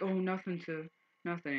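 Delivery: high-pitched, submissive imitation; mock polite. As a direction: play the tough guy suddenly backing down politely. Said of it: "He'll act all polite and shit".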